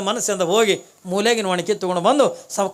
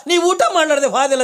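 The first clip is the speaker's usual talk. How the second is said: wailing cry; 9 semitones higher, 4 dB louder than usual. Acted. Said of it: "ಕಣ್ಣೀರು ಹಾಕ್ಲಿಕ್ಕತ್ತ".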